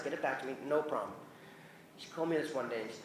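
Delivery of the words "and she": ordinary talk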